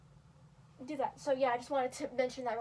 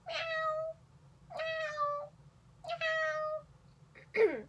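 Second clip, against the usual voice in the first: high meow, barely a hint of M; meow with silent M; much slower than usual. Shown as a demonstration. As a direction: drop the M, just a pinch of it. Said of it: "you don't really wanna pronounce the M either".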